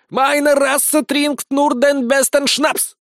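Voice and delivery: high, loud, drawn-out mock German; unrelenting rant. A narrator's reading. Sergeant keeps demanding the best schnapps, ranting. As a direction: declaim proudly in bad German, refusing to calm down. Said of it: "не унимался сержант".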